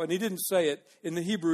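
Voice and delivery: ordinary talk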